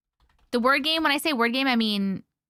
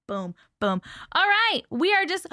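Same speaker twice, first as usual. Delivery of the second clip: ordinary talk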